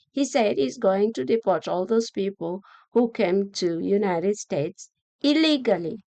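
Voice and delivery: ordinary delivery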